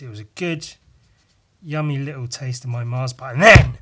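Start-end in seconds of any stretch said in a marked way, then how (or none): 3.3-3.8 sudden loud "then"; loud dramatic. Real, not acted. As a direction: punch "then" loudly for suspense. Dramatic build-up in story.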